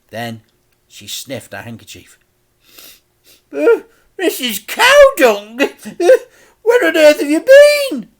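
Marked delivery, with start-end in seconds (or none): 3.5-8.1 put-on an outraged old lady; Auntie Flip, shrieking. A narrator's reading.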